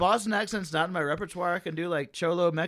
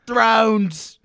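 loud, high mock Boston accent; exaggerated Boston shout; imitated Boston accent; 7 semitones higher, 11 dB louder than usual. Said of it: "you were just like".

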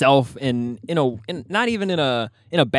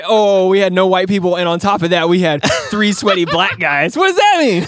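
high, loud mock indignation; mock outrage; 5 semitones higher, 7 dB louder than usual. Real, not acted. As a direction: squeal it in playful outrage.

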